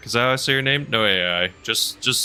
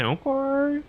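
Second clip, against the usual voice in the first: squeaky okay. Pretend. Squeaky exclamation.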